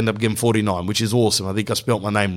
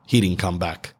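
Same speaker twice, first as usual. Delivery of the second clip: ordinary delivery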